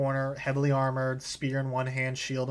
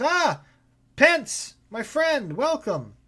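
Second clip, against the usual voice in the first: a higher, lifted innkeeper's welcome; innkeeper greeting; 12 semitones higher, 6 dB louder, much slower than usual. Acted. Dwarven innkeeper greets his friend Pence, voice lifted high. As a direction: greet an old friend from behind the bar, voice pitched up. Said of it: "he goes".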